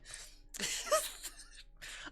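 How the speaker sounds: high giggle fit; high-pitched laugh. Real, not acted. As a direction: burst of high squeaky giggling. Laughing at sack of rice joke.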